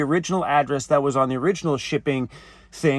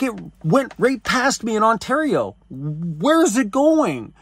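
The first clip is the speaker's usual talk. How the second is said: high-pitched, bewildered retelling; exasperated disbelief; 6 semitones higher than usual. Retold or imitated.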